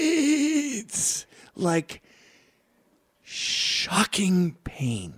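high strained squeal dropping into slow words; hammed-up agony. Comic describing his hangover, drawn-out pained delivery. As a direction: draw it out as if in pain.

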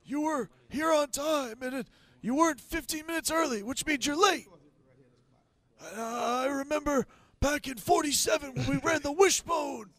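high, slow mock yell of a crusty old coach. Host imitating the old coach scolding Leonard, high mock yell.

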